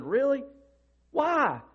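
high, startled double question; a jump up in pitch, caught off guard; surprised student. Retold or imitated. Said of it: "His eyes widened and blinked".

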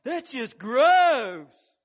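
mock kid disgust, stretched and loud; kids grossed out. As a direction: squeal it like a disgusted teenager. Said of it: "it was like".

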